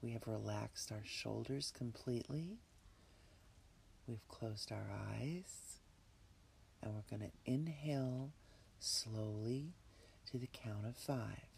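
breathy whisper, calm and unhurried; guided meditation. Acted. Leads a relaxation meditation.

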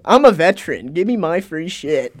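hammed-up demand for freebies; a hint of a pushy old customer; mock entitled veteran. Mocking future veterans demanding freebies.

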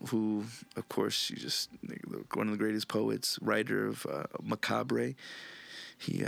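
ordinary talk

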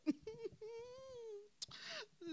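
high squeaky giggle; a gleeful little squeal of a laugh. Acted. Narrator laughing as Karma, high gleeful giggle.

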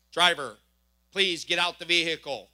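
a hint of a stern cop barking orders; police command. Officer ordering the driver out, mild stern cop command. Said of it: "she says".

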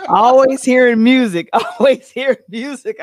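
loud and laughing. Real, not acted. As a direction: say it through laughter. Laughing at friend's music habit.

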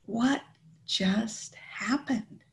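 slow, near-whispered disbelief; hushed, re-enacted bewilderment; stunned. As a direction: breathe it out slowly, word by word. Re-enacts sitting there stunned afterwards.

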